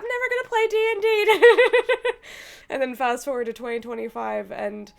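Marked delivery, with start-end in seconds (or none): 0.0-2.7 high, loud laughing quote. Retold or imitated. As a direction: laugh at your past self. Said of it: "listening to myself saying".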